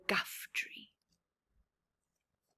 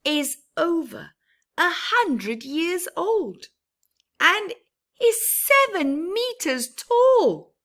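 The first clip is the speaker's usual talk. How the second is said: rising higher and louder with wonder; building awe; 11 semitones higher, 13 dB louder, slower than usual. A narrator's reading. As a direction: build up the size of it. Growing awe about father tree.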